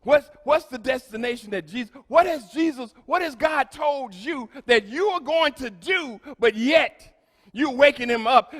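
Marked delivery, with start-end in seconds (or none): none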